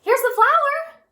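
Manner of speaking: high, loud, stretched shout; boy shouting with joy; excited shout. A narrator's reading. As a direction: call out proudly with the flour. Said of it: "Jack shouted".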